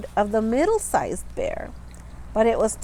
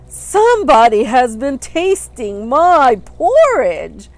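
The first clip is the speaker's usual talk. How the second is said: great big bear voice; 8 dB louder than usual. A narrator's reading. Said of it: "in his great big voice".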